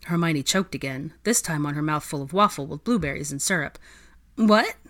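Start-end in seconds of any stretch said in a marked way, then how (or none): 4.4-4.8 startled high squeak. Acted. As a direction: squeak it out in surprise. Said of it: "she squeaked".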